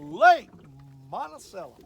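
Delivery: big loud call of Lake; hyped shout; shouted name. Acted. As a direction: belt out Lake like an announcer.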